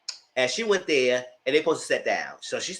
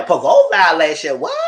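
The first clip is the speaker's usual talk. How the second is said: retold surprise; 3 semitones higher, 12 dB louder than usual. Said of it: "And she said".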